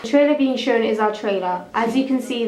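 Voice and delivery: ordinary delivery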